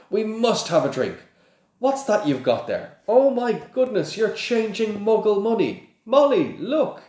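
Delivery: high, loud, gushing wonder; delighted excitement. A narrator's reading. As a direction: exclaim in high-pitched fascination. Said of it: "He pointed excitedly".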